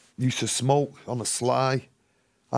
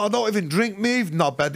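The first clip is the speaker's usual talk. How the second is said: higher mock-indignant snap; cheeky retort; 7 semitones higher than usual. Retold self insulting the bouncer, mock indignant. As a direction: brush the bouncer off with contempt. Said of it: "And I've gone".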